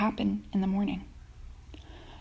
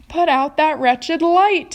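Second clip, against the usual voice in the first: high, loud, slow furious yell; angry shout; 8 semitones higher, 9 dB louder, slower than usual. Acted. Centipede shouting at the glowworm to put out her light, slow loud yell. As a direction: bellow it slowly at the glowworm. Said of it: "he shouted".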